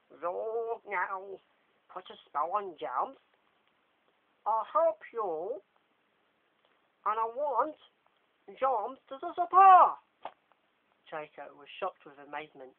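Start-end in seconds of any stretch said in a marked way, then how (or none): 0.1-10.0 loud, slow, high scheming voice; Lockhart's scheming. Acted. Lockhart to Draco, loud scheming plan. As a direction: rise into a booming demand.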